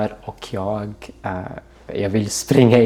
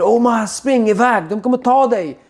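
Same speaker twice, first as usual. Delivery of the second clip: desperate shout; 11 semitones higher, 3 dB louder than usual. Retold or imitated. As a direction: yell at Omar to run for his life. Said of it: "bara skriker".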